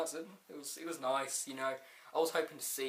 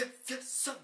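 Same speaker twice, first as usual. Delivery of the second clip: wrestler catchphrase impression; 12 semitones higher, 4 dB quieter than usual. The Rock interrupts with his catchphrase, mock showboating. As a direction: stretch out the hero's famous line.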